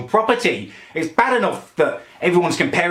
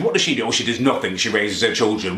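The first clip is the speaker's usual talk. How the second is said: usual speaking voice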